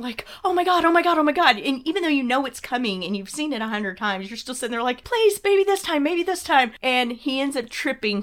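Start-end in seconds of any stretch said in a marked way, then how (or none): 0.4-1.6 higher, urgent repeated plea; anxious rush, pitched up; mock panicking. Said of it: "you're already panicking". 5.0-6.7 high-pitched pleading; anxious mock plea; desperate hoping. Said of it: "you're already panicking".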